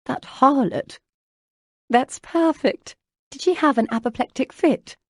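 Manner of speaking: high, loud, relishing the scandal; gleeful gossip. A narrator's reading. Lucy reacts to Jane besting Isabella, with scandalized glee. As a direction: delight in a rival's discomfort.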